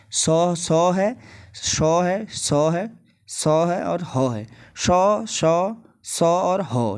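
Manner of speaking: drawn-out hissing सौ sounds; sibilant demo. Shown as a demonstration. Teacher sounds out सौ and हौ for the class, slowly. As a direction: stretch each सौ slowly to show the hiss. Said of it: "सौ का भी अलग-अलग उच्चारण होता है".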